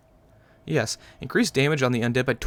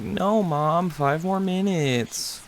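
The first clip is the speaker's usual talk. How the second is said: put-on higher, slow whining kid voice; whiny little kid; 6 semitones higher, much slower than usual. Kid begging mom for more time, whining. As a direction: drag out "five more minutes" like a sulky child. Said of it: "I feel like a little kid".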